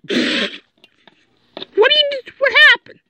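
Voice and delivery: very high, slow squeaky voice; silly high-pitched mock voice.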